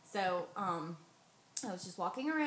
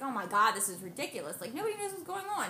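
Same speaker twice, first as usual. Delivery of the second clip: loud exasperated Oh my God, then higher and fast; fed-up outburst; 3 semitones higher, 4 dB louder, faster than usual. Retold or imitated. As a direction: vent that nobody knows anything. Venting frustration at hospital.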